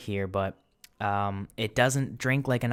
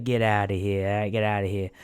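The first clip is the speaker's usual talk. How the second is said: Italian impression. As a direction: dismiss them with a mock Italian-American accent. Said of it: "That's why I went into my Italian impression".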